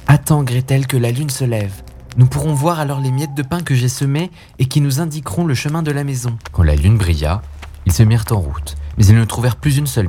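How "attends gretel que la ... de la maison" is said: lightly voiced young boy, a bit higher; just a hint of a comforting little brother; gently raised boy voice; Hansel. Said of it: "Hansel consola sa petite sœur en disant".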